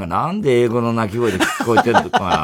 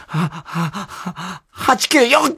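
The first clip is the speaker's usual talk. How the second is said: sneezing; 4 semitones higher, much slower than usual. Acted. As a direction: fake sneeze that becomes a word. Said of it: "ずっとくしゃみが".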